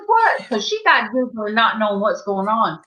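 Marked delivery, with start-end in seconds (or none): 0.1-0.5 very high-pitched "what"; retold startled question. Mama hearing his name, retold startled question. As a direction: imitate a giggly, baffled mama, high. Voicing Mama's reaction.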